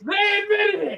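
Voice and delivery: very high squealed accusation; mock-outraged cry. Catching a co-host admitting to scamming, very high cry. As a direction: crow it way up high. Repeats the crowing yell.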